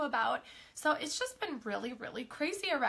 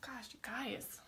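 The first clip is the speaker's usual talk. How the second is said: breathy whispered aside; 10 dB quieter than usual. Real, not acted. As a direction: drop to a whisper. Hushed aside to viewers.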